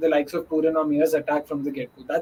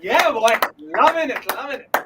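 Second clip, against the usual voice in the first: loud, high, delighted whoop; excited cheer; 5 semitones higher, 5 dB louder than usual. Real, not acted. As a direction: cheer it like a big six. Cheering a boundary, then accent remark.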